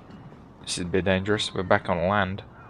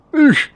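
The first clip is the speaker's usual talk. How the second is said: short, high, loud "oosh"; sharp high effort noise; mock jump grunt; 8 dB louder than usual. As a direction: burst it out as if leaping. Imitating effort of jumping waves.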